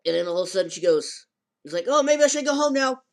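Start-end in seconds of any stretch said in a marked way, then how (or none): 1.9-3.0 high, loud nervous bail-out; hammed-up nervous boy; panicky squeal. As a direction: squeak it trying to escape. Boy flees awkwardly.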